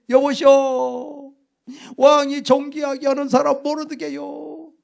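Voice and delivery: loud, high public announcement, first word stretched out slowly; humiliated Mordecai's loud cry to the townspeople; forced proclamation. Acted. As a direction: shout it to the gathered crowd, pitched high. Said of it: "소리를 질러야지".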